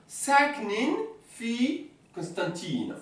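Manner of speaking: saknin fi Constantine said very slowly; slow dictation. Shown as a demonstration. Teacher dictating example slowly. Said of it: "Kadour wa Fatima".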